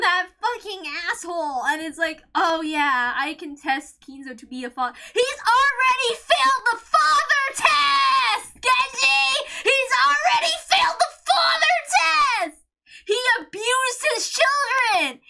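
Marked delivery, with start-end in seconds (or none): 5.1-15.2 angry rant. Real, not acted. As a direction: shout it in real exasperation. Angry rant about a story character.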